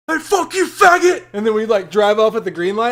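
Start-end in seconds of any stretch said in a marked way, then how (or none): 0.1-1.3 high, loud yelled insult; taunting yell. Retold or imitated. Friend yelling at a stranger at a red light, loud high taunt. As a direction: yell at him to start a fight. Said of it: "he would just go".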